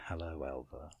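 soft, low seductive whisper; sultry voice. Pretend. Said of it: "don't use your sultry voice".

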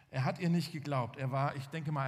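ordinary delivery

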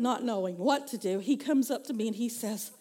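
ordinary talk, nothing marked

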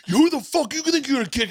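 high, loud menacing bark; furious big brother shouting; threatening yell. Retold or imitated. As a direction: threaten the kid loudly.

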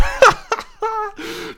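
shrill burst of laughter; high laugh. Real, not acted. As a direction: cackle high at your own awkwardness. Laughs at failed small talk.

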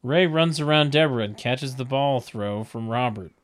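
usual speaking voice

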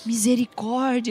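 misericordia lenta, un po' più bassa; tenero imbarazzo. Retold or imitated. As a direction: imita un pastore mortificato, con garbo. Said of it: "bem bonitinho".

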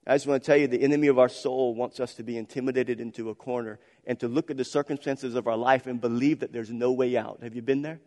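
ordinary talk

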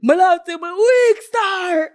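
imitating voice-note man. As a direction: proclaim it high, like the man on the recording. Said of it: "the man in the voice note said".

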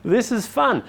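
high, amazed and gleeful; suddenly discovering it's fun; delighted surprise. Retold or imitated. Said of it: "they think".

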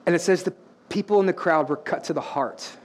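low, softened preacher's voice; measured, reverent phrases after the climax; hushed solemn preaching. Real, not acted. Preacher drops to hush after climax.

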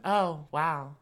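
husky femme fatale drawl; sultry voice. Retold or imitated. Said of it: "she talks like this".

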